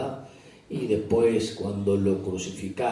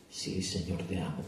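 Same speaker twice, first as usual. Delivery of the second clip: much softer and lower, barely voiced; humble quiet reply; 4 semitones lower, 11 dB quieter than usual. Peter answers his Lord, soft and humble. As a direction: answer humbly under your breath.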